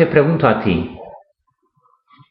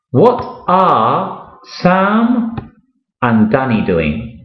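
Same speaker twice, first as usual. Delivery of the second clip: slow model question; slower than usual. Shown as a demonstration. Teacher modelling the question slowly for a beginner, stretched out. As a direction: drag each word for the learner to follow. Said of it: "teacher models question slowly".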